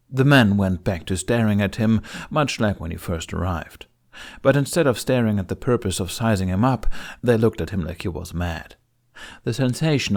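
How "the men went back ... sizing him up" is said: plain reading voice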